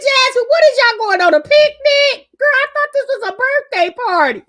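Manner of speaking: high, loud, laughing mock question; laughing quote. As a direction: squeal it through tears of laughter. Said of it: "I said".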